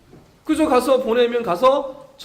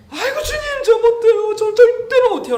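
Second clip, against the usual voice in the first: much higher, louder, scream-like cry for help; screaming plea; 8 dB louder than usual. Retold or imitated. Believer crying out to the Lord to be spared, screaming plea. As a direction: shriek it as if begging for rescue. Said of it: "비명소리와 같은 살려달라고 외치는 소리".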